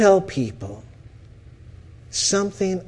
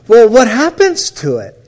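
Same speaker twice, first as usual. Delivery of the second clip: louder, higher, incredulous; amazed question; 7 semitones higher, 9 dB louder than usual. Retold or imitated. Counselor pressing his client, loud and amazed. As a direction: throw the question at her in disbelief.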